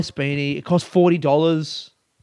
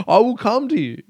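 mock menace; 8 semitones higher, 3 dB louder than usual. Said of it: "I'm trying to get angry".

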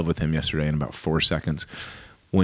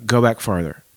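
normal talking voice

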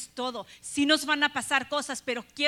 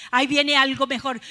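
"Ahí viene algo mejor" declared much louder; proclaiming; 8 dB louder than usual. Shown as a demonstration. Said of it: "puedes pensar tú".

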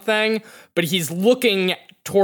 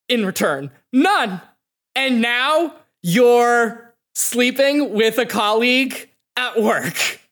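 hurt anger; 7 semitones higher than usual. Acted. Mother voiced by game master, furious at her husband's affair. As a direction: let the betrayal boil over, jabbing on None.

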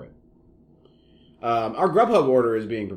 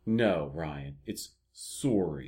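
quiet Canadian correction, "sorry" drawn out; Canadian accent; 6 semitones lower, 11 dB quieter, much slower than usual. Retold or imitated. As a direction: imitate a Canadian saying "SORE-ee". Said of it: "Both Canadian, I believe".

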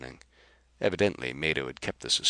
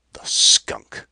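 angry outburst; 12 dB louder than usual. Acted. Kar furious at Rapaju's scheme.

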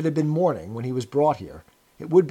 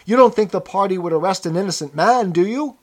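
loud, high appeal for agreement; raised-voice pleading question; servile protest; 6 semitones higher, 8 dB louder than usual. A narrator's reading. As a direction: protest eagerly to please those in power. Servile prisoner protesting his guilt.

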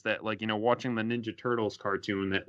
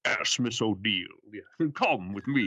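lightly voiced robot villain, slowed; a hint of a gallant Megatron; 2 semitones lower than usual. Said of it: "Transmetal two Megatron trying to save April O'Neil".

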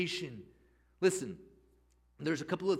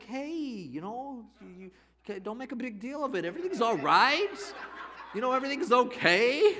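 nervous laughing reassurance; 7 semitones higher, 4 dB louder than usual. Retold or imitated. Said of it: "I'm looking over here like".

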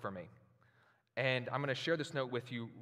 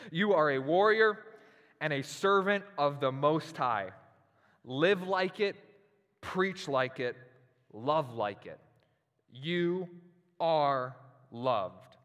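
impassioned letter reading; 3 semitones higher, 8 dB louder, slower than usual. Acted. Pastor proclaiming his mentor's letter to the congregation, loud and stirring. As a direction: proclaim each line like a battle cry.

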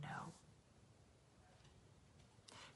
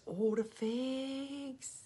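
imitating Di; 6 semitones higher, 8 dB quieter, much slower than usual.

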